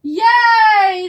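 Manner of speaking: high, loud cheering yay. Acted. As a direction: cheer for the rescue. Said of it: "the crowd cheered".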